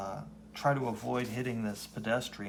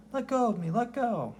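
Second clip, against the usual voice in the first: put-on scared kid; 13 semitones higher, 4 dB louder than usual. Young Matt grabbed by bullies.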